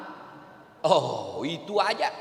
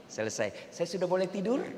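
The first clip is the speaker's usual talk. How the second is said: meek husband; 7 dB quieter than usual. Retold or imitated. Husband asking his nagging wife if he may sleep, meek and soft. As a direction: ask sweetly, meekly after the scolding. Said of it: "Sudah ngomel".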